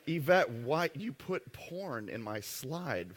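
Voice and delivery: slow, drawn-out, teasing complaint; mock accusation. Real, not acted. A presenter teasing his assistant Yvette about the slide, slow and mocking. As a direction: tease her for the slide, stretching it out.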